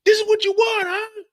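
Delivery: high, loud taunting call; hammed-up high-pitched street challenge; mock street taunt. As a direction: taunt him to come get you.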